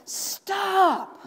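a high, sharp cry of "Stop!"; mock shout at the old man. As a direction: yell it as hot tea burns. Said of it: "she shouted to the little old man".

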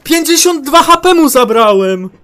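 very high, loud protest; outraged shout. Real, not acted. Gamer raging at damage he dealt, outraged shout. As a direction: yell it out in disbelief.

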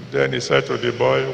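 usual speaking voice

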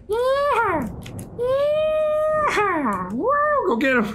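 high excited cheer. Real, not acted. Cheering in game.

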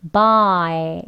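buy with the mouth left half open at the end; buy demo. Shown as a demonstration. Teacher shows buy with a half-open mouth, careful demo. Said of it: "이렇게요".

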